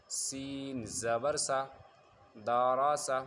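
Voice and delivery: slow, measured chant with long-held letter names; drawn-out letters, short joined syllables; spelling recitation. Shown as a demonstration. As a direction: recite like a Qaida teacher, stretching each letter name. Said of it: "چلیے شروع کرتے ہیں".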